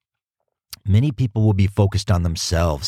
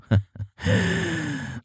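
high laugh; 6 dB quieter than usual. Real, not acted. Laughs at own joke.

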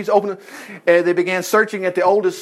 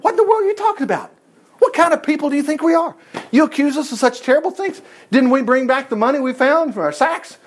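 higher, fast, outraged denial; indignant protest; 7 semitones higher than usual. Acted. Brothers protest to Joseph's steward, indignant and higher. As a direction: bristle at the accusation.

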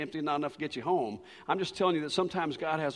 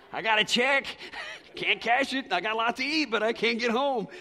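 loud, high-pitched mock wail; hammed-up stranded man's complaint; comic exasperation; 9 semitones higher, 7 dB louder than usual. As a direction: protest the absurd predicament at full voice. Acted comic exasperation at being stranded.

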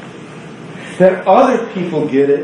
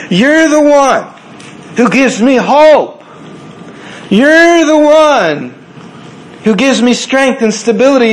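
voiced higher and louder, slowed; Martha pleading; 6 semitones higher, 4 dB louder than usual. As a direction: plead with Jesus, leaning hard on you're the one. Preacher voices Martha pleading with Jesus.

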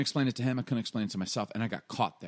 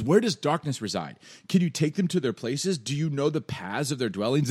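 raised, pressing mock interrogation; God; 5 semitones higher, 4 dB louder than usual. God grilling Job, high mocking challenge. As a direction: fire off pointed rhetorical questions. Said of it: "this is God kinda mocking".

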